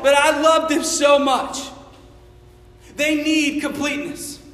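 high, loud, slow shouted preaching; full-force preaching. Acted. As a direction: preach it with everything, crying out.

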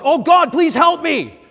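high, loud, drawn-out cry for help; a man pinned by a bear yells to God, high and loud; desperate yell. Acted. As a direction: scream it to the sky in terror. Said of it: "and he yells out".

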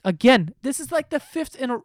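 real voice crack. Real, not acted. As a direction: pitch cracks high mid-word. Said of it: "my voice cracked".

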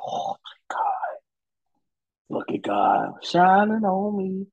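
whispered, then slow, grateful praise; relieved whisper. Retold or imitated. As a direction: breathe out thanks, amazed at the luck. Let off with a warning.

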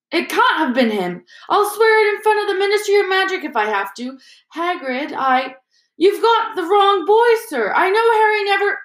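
high, loud, agitated ranting. Acted. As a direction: rant on, won't be stopped. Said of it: "Hagrid went ranting on".

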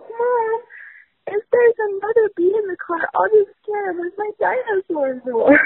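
high, earnest imitation of a small child; little son's voice. Mom imitating her four-year-old offering to protect her, high childlike voice. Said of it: "he's like".

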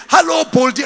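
children's mocking call; mocking kids. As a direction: jeer like kids teasing. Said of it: "mock him and say".

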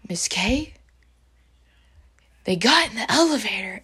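gasp, then a breathy urgent report; mock-panicked little messenger; retold alarmed child. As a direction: imitate a kid breathlessly reporting trouble.

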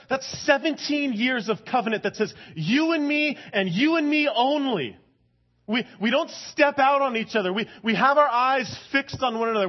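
high, loud, impassioned preaching; fervent sermon build; preaching at full force. Acted. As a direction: pour it out like a pulpit climax. Preaching on covenant and jealousy.

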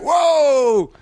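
high, long whoa of delight; cheery whoop. Retold or imitated. Comic re-enacting his reaction to a drunk guy, cheery whoop. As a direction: whoop it merrily, drawing it out. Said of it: "I couldn't have been cheerier about it".

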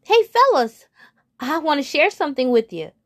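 high, loud eager call; eager frog. Acted. Frog calling his friends over, high and loud. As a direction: shout across the pond, bursting with news. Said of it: "and says".

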